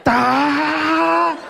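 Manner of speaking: high straining battle cry "Da!"; battle yell. Retold or imitated. Screaming at the guy he's fighting, high battle cry. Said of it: "I'm yelling".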